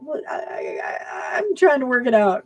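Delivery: high, strained mock protest; hammed-up flustered teenager; whiny son imitation. Mom mimicking son's excuses about the bike, high whiny voice. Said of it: "imitating son's protest".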